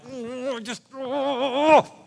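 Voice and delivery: high, strained wordless groan; mock frustrated moans. Pastor imitating lovers dying to be together, mock moaning. Acting out the lovers' pent-up longing.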